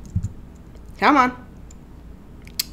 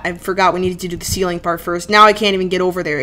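ordinary talk

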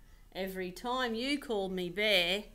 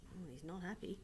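usual speaking voice

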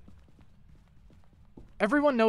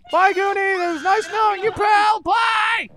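being chased; 11 semitones higher, 6 dB louder than usual. Real, not acted. Fleeing monster, farewell to friend.

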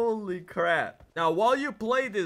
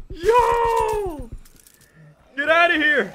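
shouting; 8 semitones higher, 7 dB louder, much slower than usual. Real, not acted. Yelling at zombies.

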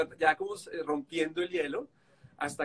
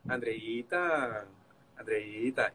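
soft, slow, lower coaxing of her name; 7 semitones lower, 3 dB quieter, much slower than usual. Retold or imitated. Husband coaxing his sulking cohost, slow and soft. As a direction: draw her name out gently to win her back.

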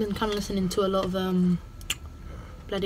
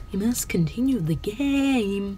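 usual speaking voice

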